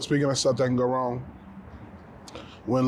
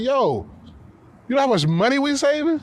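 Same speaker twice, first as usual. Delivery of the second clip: high, loud gleeful boast; excited; 12 semitones higher, 3 dB louder than usual. Retold or imitated. Telling his mad partner about the savings, high gleeful crow. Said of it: "I'm like".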